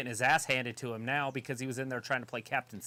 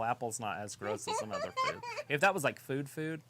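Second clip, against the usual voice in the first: usual speaking voice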